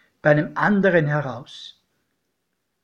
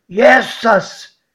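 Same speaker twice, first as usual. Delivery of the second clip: startled cry, higher and louder; godfather cries out; 6 semitones higher, 6 dB louder than usual. A narrator's reading. Godfather sees the smoking train below, startled outcry. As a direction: blurt his name in fright. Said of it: "schrie mein Pate".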